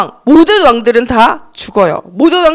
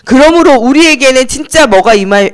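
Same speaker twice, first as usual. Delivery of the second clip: normal talking voice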